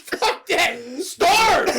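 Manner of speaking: loud, high comic refusal; mock defiance. Refusing to feed the creatures, defiant mock shout. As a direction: yell it heartlessly for laughs.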